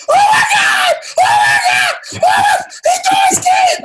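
imitating a frightened girlfriend shrieking. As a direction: squeal in mock terror at the thrown keys.

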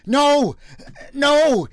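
loud, high, alarmed protest; two big, high, pleading cries; crying out. A narrator's reading. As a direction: cry out in urgent denial. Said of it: "he cried out".

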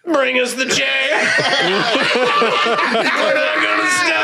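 laughing through a defiant shout; laughing defiance. Retold or imitated. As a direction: cackle it out, refusing to stop. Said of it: "And I went".

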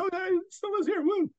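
excited exclamation. Retold or imitated. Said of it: "It's like".

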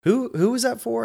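high, lightly voiced suspicious nurse; a hint of a nosy head nurse; nurse's voice. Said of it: "and she says".

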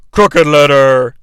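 Crooked Letter stretched slow and low; Crooked Letter drawn out slow and low. Shown as a demonstration. Repeating the wrestler's name, slow and low.

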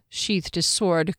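plain reading voice

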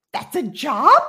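stunned at the job, half laughing; retold disbelief. Hearing about the medium, surprised disbelief.

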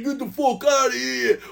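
high, mocking English-Jersey mashup; English Jersey accent. Imagining English colleagues making fun of them, mock Jersey accent. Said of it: "trying to make fun of me in an English Jersey accent".

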